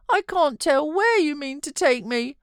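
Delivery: put-on a fretful child pleading to stay; sickly child. A narrator's reading. Boy refusing to leave his uncle, high fretful whine. Linton protesting to nurse.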